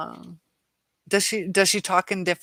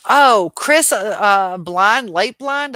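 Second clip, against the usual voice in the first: excited recognition; 4 semitones higher, 12 dB louder, slower than usual. Real, not acted. Recognizing a name.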